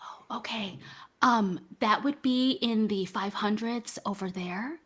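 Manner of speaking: hushed librarian whisper; library whisper. Acted. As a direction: keep it down, like a librarian shushing. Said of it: "if you could use your library voice".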